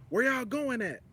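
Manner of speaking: stranger's Louisiana accent, lightly imitated; mock Louisiana accent. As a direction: throw the question out in a Louisiana lilt. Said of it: "We don't talk like that in Texas".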